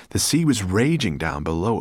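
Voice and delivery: plain reading voice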